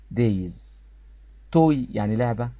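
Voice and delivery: ordinary delivery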